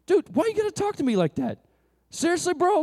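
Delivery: high, hammed-up hurt dude; mock offended buddy. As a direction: play the wounded bro for laughs. Friend voiced, mock offended.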